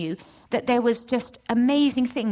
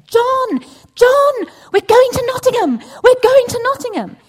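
high, loud, thrilled calling out; bursting with the news, call it up the house; excited shout; 13 semitones higher, 12 dB louder than usual. Retold or imitated. Wife bounding downstairs shouting the news to John, thrilled loud calling.

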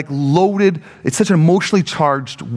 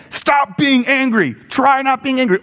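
mock stern command; 9 semitones higher than usual. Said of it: "It's not just saying".

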